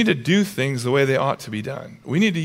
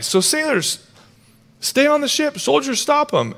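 much higher, urgent shouted commands; Paul shouting to soldiers on the sinking ship; yelling orders; 10 semitones higher than usual. Retold or imitated. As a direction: bark the orders over the storm. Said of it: "he yells at the soldiers".

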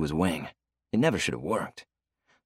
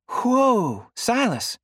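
much higher, louder excited cry; excited outburst; 5 dB louder, much slower than usual. Acted. Heidi spots the singer on TV, excited outburst. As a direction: burst out at the screen. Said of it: "Heidi's outburst".

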